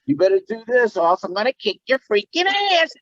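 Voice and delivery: squeaky mock tough guy; Pee-wee. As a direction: deliver the famous line in character. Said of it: "they want me to say".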